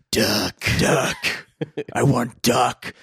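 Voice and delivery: Batman voice. Pretend.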